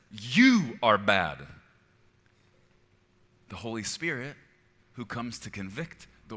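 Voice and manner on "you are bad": very loud, slow accusation; voice of condemnation. Acted. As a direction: boom out a drawn-out condemning verdict. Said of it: "Okay, condemnation says".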